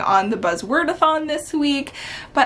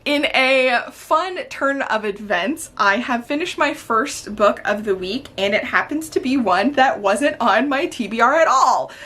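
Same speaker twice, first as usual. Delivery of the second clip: ordinary talk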